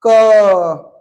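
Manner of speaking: क को साफ़ ज़ोरदार बोलना; व्यंजन क, ज़ोर देकर. Shown as a demonstration. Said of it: "ध्यान सुनना". (ध्यान से सुनाया गया क)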